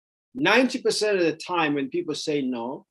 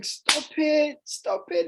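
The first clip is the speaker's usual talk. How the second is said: mock parent scolding; 13 semitones higher, slower than usual. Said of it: "You start saying".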